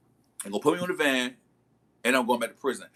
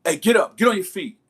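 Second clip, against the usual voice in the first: loud, higher barked command from a jail guard; guard barking orders; 6 semitones higher, 6 dB louder than usual. Retold or imitated. Guard yelling at a prisoner to get up, loud commanding bark. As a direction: shout him awake like a guard at the cell door.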